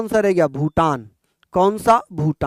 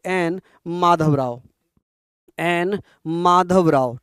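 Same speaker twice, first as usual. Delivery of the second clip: slow dictation; slower than usual. Shown as a demonstration. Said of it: "कौन थे?".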